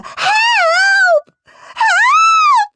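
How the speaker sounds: drawn-out, very high panicked shrieks; shrieking. A narrator's reading. Peter shrieks for his parents, high frantic cries. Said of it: "shrieked Perfect Peter".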